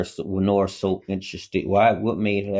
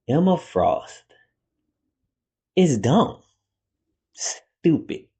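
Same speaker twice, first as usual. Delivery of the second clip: emphatic, raised pitch with long pauses; proud declaration; 6 semitones higher, 3 dB louder, much slower than usual. Real, not acted. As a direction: say it with your chest, word by word. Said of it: "Say it with my chest. Say it proudly".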